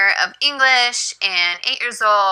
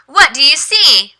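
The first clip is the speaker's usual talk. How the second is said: bright, high ESL teacher voice, higher; 5 semitones higher than usual. Shown as a demonstration. Teacher demos the question to her student, high and bright. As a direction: ask it slowly like to a young learner. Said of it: "ask them to read what it says".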